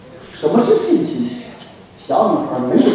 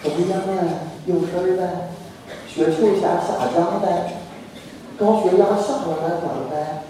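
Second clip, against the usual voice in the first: softer, slow, sighing sympathy; mild mock pity, drawn-out sighs; hollow phone sympathy; 3 semitones lower, 7 dB quieter than usual. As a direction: play a caller tutting over the sickbed. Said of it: "他打电话都是安慰你".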